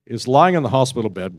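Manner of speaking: usual speaking voice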